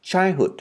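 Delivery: put-on "childhood" with the first syllable strong, then falling; teacher's careful "childhood", first syllable leading; stress on first syllable. Shown as a demonstration. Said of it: "Vậy thì sẽ đọc là childhood".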